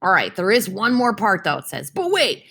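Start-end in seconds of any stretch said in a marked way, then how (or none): 1.9-2.4 dramatic but wait. Acted. Reading dramatic slide text.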